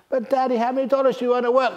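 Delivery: put-on high, fast little-boy voice; excited kid asking his dad; child's voice. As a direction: pipe up like an eager six-year-old.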